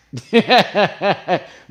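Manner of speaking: loud high burst of laughter; delighted laugh. Real, not acted. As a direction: cackle in quick high bursts. Delighted memory of his voice.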